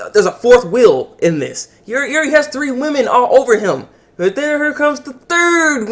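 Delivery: ordinary talk, nothing marked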